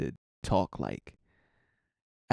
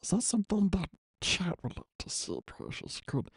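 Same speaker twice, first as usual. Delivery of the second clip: Gollum voice; 5 semitones higher than usual. Acted. Gollum voice asking chat.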